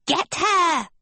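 high-pitched cry to seize someone; high, urgent character cry. Acted. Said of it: "Arachne cried".

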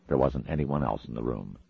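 usual speaking voice

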